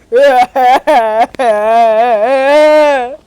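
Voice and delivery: long, loud cackling laugh; loud crazy laugh. Acted. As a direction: let out a drawn-out madcap laugh. Crazy outburst after "stop the madness. (ضحكة مجنونة عالية وطويلة)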